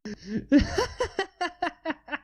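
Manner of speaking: high cackling villain laugh; mocking laugh. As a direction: laugh down at them. Villains mock the heroes.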